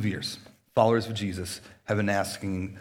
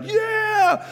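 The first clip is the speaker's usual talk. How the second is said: much higher whoop, let it rip; wild cheer; 5 dB louder than usual. Shown as a demonstration. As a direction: cheer like kids going crazy at a party. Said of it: "just get all crazy and like".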